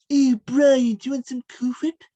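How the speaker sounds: high-pitched cartoon baby impression; Stewie Griffin. Host quizzing guest with an impression, high cartoon voice. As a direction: pitch it up like an animated know-it-all infant. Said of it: "I'm gonna do an impression".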